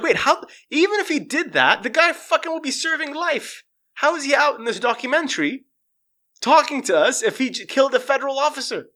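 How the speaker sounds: high-pitched, astonished questioning; incredulous. Retold or imitated. Viewer questioning how a convicted man is free, incredulous. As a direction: push the disbelief up high.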